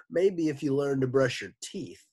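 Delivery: ordinary delivery, nothing marked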